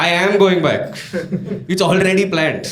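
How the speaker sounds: mild mock yell; indignant retold retort; yelling back. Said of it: "So then I yelled back".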